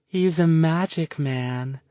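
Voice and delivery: high, slow, dreamy sing-song lilt. A narrator's reading. Spacey girl teasing about the mage, sing-song. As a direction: float the words up like a child's rhyme. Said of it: "said in a sing-song voice".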